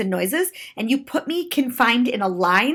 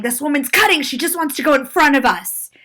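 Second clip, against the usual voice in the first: higher, indignant mock yell; imitated yell; 4 semitones higher, 4 dB louder than usual. Woman complains to others in line, imitated yell. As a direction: call it out to rally a crowd. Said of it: "she's yelling".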